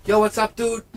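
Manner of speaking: mock bro greeting in an opera house; hammed-up casual dude. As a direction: blurt it out like an out-of-place bro. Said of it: "someone in the opera house is like".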